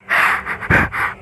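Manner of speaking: sharp airy gasp of fright; frightened gasp after the line goes dead; breathless gasp. Acted. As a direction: suck in air in panic. Call cut off after murder plot.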